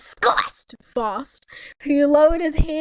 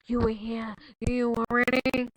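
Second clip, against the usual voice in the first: ordinary delivery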